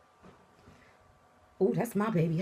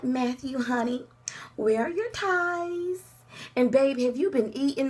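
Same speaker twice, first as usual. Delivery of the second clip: mock sweet; 7 semitones higher than usual. Wife mock-fussing over her husband in court, syrupy. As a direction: coo at him like a fussy wife. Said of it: "I'm like".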